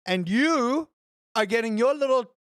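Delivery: exasperated streamer scolding chat; scolding a viewer. Real, not acted. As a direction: put the entitled viewer in their place.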